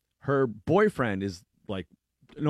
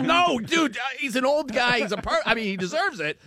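laughing protest; 12 semitones higher, 3 dB louder than usual. Retold or imitated. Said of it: "I'm like".

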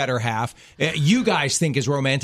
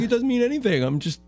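dad, voice higher, dismissive; imitating dad; 8 semitones higher, faster than usual. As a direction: brush it off like dad. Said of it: "he's like".

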